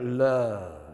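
Love dropped low and drawn out; low romantic. Shown as a demonstration. Pastor demonstrates Love going down low. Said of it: "you gotta go down".

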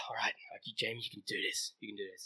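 sigh, then low, soft self-coaching; hushed self pep talk. Retold or imitated. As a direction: psych yourself up under your breath.

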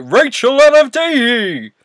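radio DJ voice. Shown as a demonstration. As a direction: belt it out like a radio host. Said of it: "you, like, drop it an octave".

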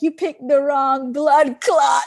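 put-on loud, slow Jamaican accent with a laugh in it; imitated Jamaican accent. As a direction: shout it like a Jamaican bus driver. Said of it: "he was definitely Jamaican".

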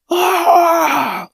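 pain scream. Acted. Cramp pain.

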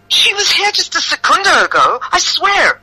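very high, pleading protest; high-pitched protest. Acted. Accused woman defends herself to the mother, high protest. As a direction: swear it in a high voice.

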